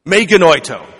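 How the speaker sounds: Me genoito called out loud and forceful; a booming Greek no from the pulpit; emphatic Greek exclamation. Real, not acted. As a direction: proclaim Me genoito like a verdict. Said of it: "It sounds better in Greek".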